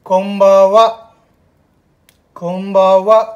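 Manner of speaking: कोम्बावा with a firm m sound, kom-ba-wa; clear model word. Shown as a demonstration. Teacher to class, showing the evening greeting: loud and clear. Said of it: "उच्चारण पे ध्यान देना आप".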